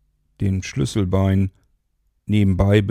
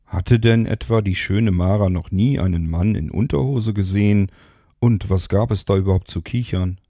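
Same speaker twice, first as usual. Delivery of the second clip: ordinary delivery, nothing marked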